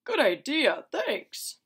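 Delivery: high, loud cheerful reply; high, loud cheery answer; Dali replying. Retold or imitated. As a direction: chirp thanks to the aliens. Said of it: "here is his mustache".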